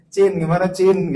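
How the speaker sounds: loud, playful mimic of a flirty maid; mock sweet talk. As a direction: tease the pet name Cin. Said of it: "gitu kan". (meniru manja, suara keras)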